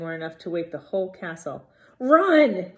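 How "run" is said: sudden loud shout of alarm; yell. A narrator's reading. Harry yelling at the others to run, loud. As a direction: yell it to make them flee. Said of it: "Harry yelled".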